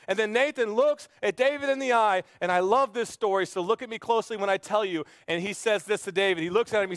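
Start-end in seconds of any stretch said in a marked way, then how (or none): none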